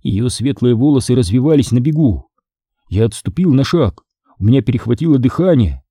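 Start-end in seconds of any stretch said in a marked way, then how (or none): none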